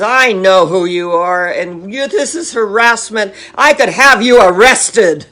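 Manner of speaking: loud, hostile retort; angry threat. Retold or imitated. Klansman snapping at the cantor on the phone, loud and angry. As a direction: bark it back at the caller.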